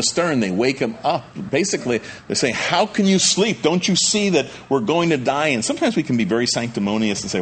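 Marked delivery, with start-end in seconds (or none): 2.5-5.5 panicked disciples. Acted. Preacher voicing terrified disciples to sleeping Jesus, panicked. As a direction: cry out like men sure they'll drown.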